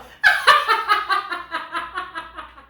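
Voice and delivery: high, loud laughing fit; uproarious laughter. Real, not acted. Reader losing it over a grown man's baby talk, uproarious laughter. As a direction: explode into a long peal of laughter.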